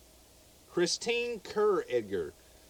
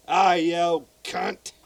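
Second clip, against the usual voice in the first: mock Australian greeting; 3 semitones higher, 7 dB louder, much slower than usual. Shown as a demonstration. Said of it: "Like that".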